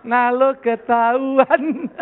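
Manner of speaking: loud laughing exclamations between laughs. Real, not acted. Pastor laughs loudly at the youth's joke. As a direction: laugh out loud, caught you.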